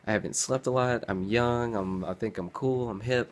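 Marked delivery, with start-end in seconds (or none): none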